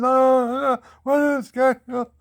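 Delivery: high, loud mock scream of pain; retold screaming. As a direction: yell it out like the bitten guy. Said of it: "He went around screaming".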